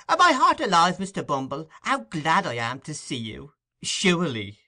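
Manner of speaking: high, loud fluttering welcome; gushing hostess. A narrator's reading. Mrs Mann fawning over the beadle, high and loud. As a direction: overplay the delight.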